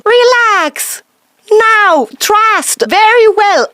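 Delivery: spiky voice. Shown as a demonstration. Coach demonstrating tense spiky voice, high and loud. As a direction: push it up high and pinched. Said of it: "the opposite of a round voice".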